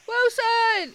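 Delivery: high, loud Wilson cry; excited shout. Real, not acted. Scene: streamer remembers the volleyball's name, high shout. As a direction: call it out like in the movie.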